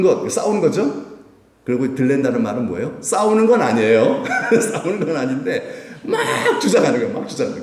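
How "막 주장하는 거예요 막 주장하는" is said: mock insistence with a laugh; 큰소리로 우기기. Shown as a demonstration. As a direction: lean hard into '막', half laughing. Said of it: "막 큰 소리 치고". (웃음 섞어 큰소리치는 시늉)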